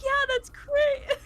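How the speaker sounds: high, loud, drawn-out fake soundbite voice; mock canned audio clip. Streamer mocking a canned audio bit for a joke, high and loud. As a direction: ham it up like a cheesy pre-recorded clip. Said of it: "and then you're just like".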